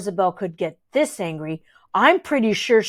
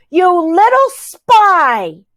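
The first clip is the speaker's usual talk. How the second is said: high, slow, loud accusing yell; furious shout; 8 semitones higher, 8 dB louder, much slower than usual. A narrator's reading. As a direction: bellow it at the spy. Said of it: "Rosabelle shouts".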